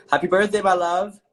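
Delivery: loud, high birthday cheer; birthday greeting. Real, not acted. Host greeting a fan on request, excited and loud. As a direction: call it out brightly to the fan.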